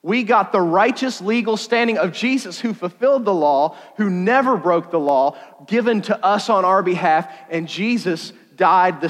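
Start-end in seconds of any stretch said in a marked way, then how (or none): none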